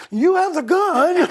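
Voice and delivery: very high squeal through laughter; a child laughing at grandma, high and loud; laughing kid. Retold or imitated. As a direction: shriek it while cracking up.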